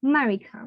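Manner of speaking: America said 'marika' with a strong K; the local America, first A dropped; America in Bengali sound; Bengali-style America. Shown as a demonstration. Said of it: "We should use".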